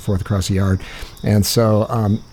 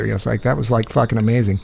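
ordinary delivery

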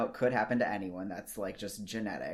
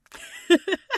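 high burst of laughter; 13 dB louder than usual. Real, not acted. Laughs at herself.

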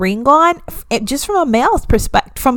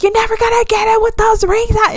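exasperated warning; 9 semitones higher, faster than usual. Retold or imitated. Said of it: "But I'm like".

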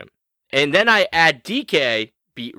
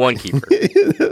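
slow, drawn-out one keeper; slow mock; much slower than usual. Host teasing co-host about the league rules, slow drawn-out. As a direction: drag out the words to tease.

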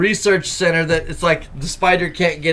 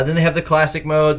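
usual speaking voice

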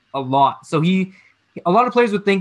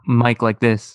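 normal talking voice

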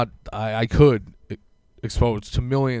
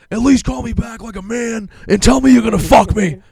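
higher and louder, yelling into the voicemail; mock furious yell; 12 semitones higher, 8 dB louder than usual. To the dealer's voicemail, a high hammed-up furious yell. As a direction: scream it like an enraged customer. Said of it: "I start screaming and swearing".

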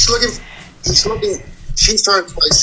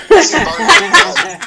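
hammed-up; 5 dB louder, much slower than usual. Drunk sailor boasting.

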